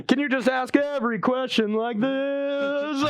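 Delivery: slow lounge-singer croon through a laugh. Pretend. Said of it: "can we speak in a [name] kind of a".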